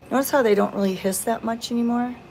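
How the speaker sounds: normal talking voice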